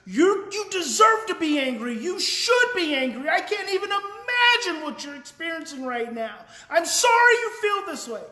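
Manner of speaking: loud and high, angry-sounding words of support; match the kid's anger at full volume; elevated voice. Retold or imitated. Parent to upset child in back seat, loud angry-sounding support. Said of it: "I said in an elevated voice".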